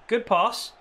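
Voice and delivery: ordinary talk, nothing marked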